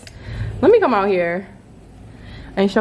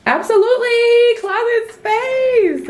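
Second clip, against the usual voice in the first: sing-song thrilled squeal; squealing delight; 3 dB louder than usual. Real, not acted. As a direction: gush over the closet like a prize. Excited reveal of closet.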